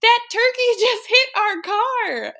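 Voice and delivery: imitated wailing little girl, high and loud; hammed-up, drawn-out tearful wail; mock child sobbing. Daughter tells mom about the turkey, mock sobbing. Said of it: "she's sobbing, and she goes".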